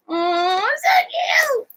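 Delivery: high squealing aww; excited squeal. Real, not acted. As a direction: gush over it, voice way up. Gushing over her artwork.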